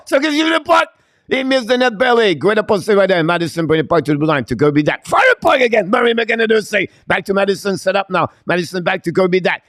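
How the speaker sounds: high-pitched, urgent hockey call; excited play-by-play. Real, not acted. Commentator calling a near miss at the net, excited. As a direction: call the chance with rising excitement, voice way up. Live call of scoring chance.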